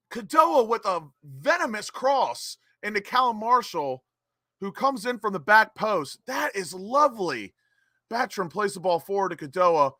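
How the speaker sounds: replay commentary. Acted. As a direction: talk the viewers through the goal. Live play-by-play of the goal replay.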